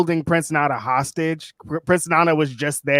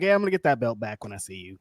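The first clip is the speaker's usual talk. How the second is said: low-key, quick, offhand promise; casual, unbothered; 4 semitones lower, 6 dB quieter, much faster than usual. Retold or imitated. Imitating Hook's mild reaction, casual and quick. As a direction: toss it off softer and lower. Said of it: "He's just like".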